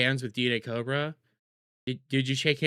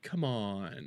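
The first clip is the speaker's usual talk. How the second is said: usual speaking voice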